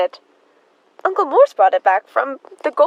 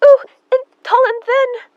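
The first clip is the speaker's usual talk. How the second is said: high, loud delighted cry; excited squeal; 12 semitones higher, 6 dB louder than usual. Acted. Emma thrilled that the reporter knows her uncle. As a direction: gush with sudden joy.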